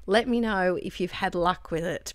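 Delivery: ordinary delivery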